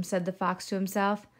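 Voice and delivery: usual speaking voice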